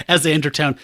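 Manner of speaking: usual speaking voice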